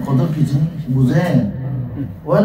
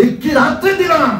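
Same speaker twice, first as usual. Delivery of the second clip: preaching shout; 10 semitones higher than usual. Real, not acted.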